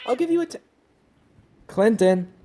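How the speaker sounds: firm, loud reprimand; sharp scolding. Real, not acted. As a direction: scold a misbehaving pet loudly. Scolding the interrupting cat.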